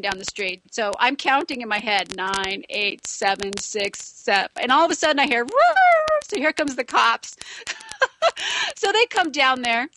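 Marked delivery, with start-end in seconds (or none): 5.4-5.7 high, loud mock roar; imitated siren roar. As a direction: belt out the noise of the arriving cops. Cops arriving with noise.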